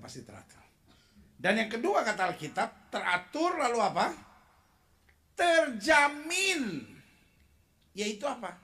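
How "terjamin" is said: very slow, louder drawn-out shout; preaching at full force to the congregation; preacher's shout. Real, not acted. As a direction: stretch the word and proclaim it. Preacher emphasizes key word.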